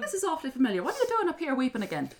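put-on high leprechaun with Kerry accent; hammed-up Irish little man. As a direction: complain like a put-upon leprechaun. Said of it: "turning into a leprechaun".

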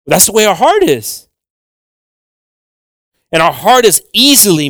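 impassioned preacher, punching key words; forceful preaching. Real, not acted. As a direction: drive the point home to the congregation.

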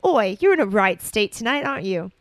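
taunting. Acted. Ron taunting.

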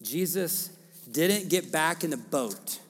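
usual speaking voice

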